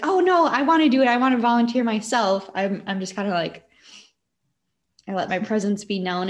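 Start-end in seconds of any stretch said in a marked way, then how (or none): 0.0-2.5 mock eager volunteer. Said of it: "I don't wanna be that person that's like".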